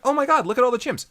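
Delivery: high, loud, rushed gushing; mock excitement. Characters spotting monkeys in the hot springs, high excited squeal of words. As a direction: gush like a thrilled tourist.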